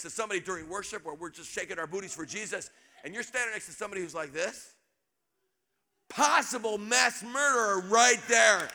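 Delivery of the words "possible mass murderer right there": slow, louder, every word weighted; slow deadpan punchline. Real, not acted. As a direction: land the joke slowly.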